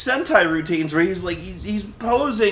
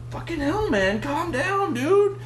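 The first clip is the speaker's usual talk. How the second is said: exasperated plea; 10 semitones higher, slower than usual. Retold or imitated. Viewer pleading with the raging elder, mock exasperated whine. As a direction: beg him to calm down. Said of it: "I'm like".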